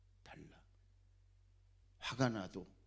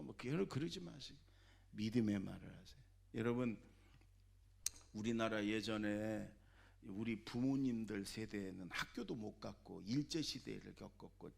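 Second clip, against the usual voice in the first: ordinary talk, nothing marked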